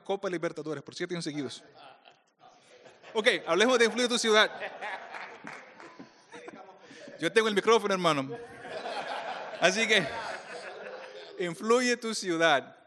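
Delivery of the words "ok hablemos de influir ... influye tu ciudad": reclaim the room with a laugh; loud, laughing, teasing retort; laughing comeback. Real, not acted. Jokingly reclaiming the mic from heckler.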